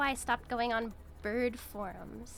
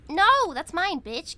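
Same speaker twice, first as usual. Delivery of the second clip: loud high shout; loud possessive game yell; 5 semitones higher, 11 dB louder, slower than usual. Real, not acted. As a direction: yell to claim it.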